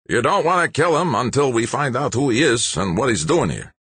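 put-on high, raspy old man's command; sandpaper-voiced old man. A narrator's reading. Old man calling off his giant friend, high sandpaper voice. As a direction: rasp it high to call off a brawler. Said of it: "said in a voice like sandpaper".

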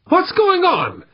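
very high, panicked question; alarmed. A narrator's reading. Operator hears screams over the comm, alarmed.

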